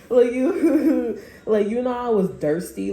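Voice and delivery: helpless giggles through a retold complaint; laughing. Real, not acted. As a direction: crack up while getting the words out. Cracks up retelling a tea quarrel.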